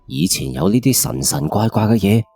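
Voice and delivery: usual speaking voice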